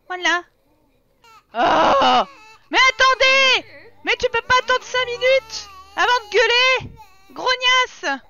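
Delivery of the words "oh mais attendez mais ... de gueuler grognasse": loud, high-pitched scolding; fed-up shout, pushed hard; exasperated yelling. Real, not acted. Yells at impatient screaming babies.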